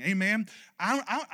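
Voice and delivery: ordinary talk, nothing marked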